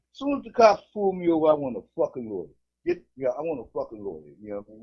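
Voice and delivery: ordinary delivery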